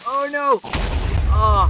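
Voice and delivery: high, loud cry of dismay; dismayed yell. Real, not acted. Gamer about to die, dismayed yell. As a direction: cry out high as it goes wrong. Player being killed in game.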